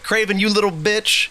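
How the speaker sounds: high-pitched, mouthy taunt; acted taunt. Trapped Spider-Man trying to talk his way out, taunting.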